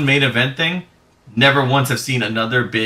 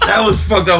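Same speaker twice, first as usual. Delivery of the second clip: louder, higher words through real laughter; laughing; 7 semitones higher, 5 dB louder than usual. Real, not acted. Player reacting to friend's murder joke, loud laughing protest. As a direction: protest while cracking up.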